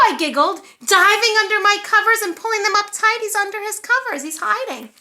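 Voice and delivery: ordinary delivery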